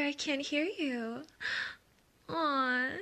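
normal talking voice

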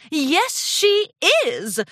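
high, loud, grand announcement; calling out. A narrator's reading. Designer making her grand entrance, calling out. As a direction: sing out proudly to be noticed. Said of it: "Rarity called out".

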